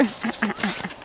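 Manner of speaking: soft quiet chuckle; a low laugh under the breath. Real, not acted. Laughing at the snowboard mix-up.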